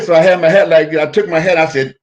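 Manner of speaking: ordinary talk, nothing marked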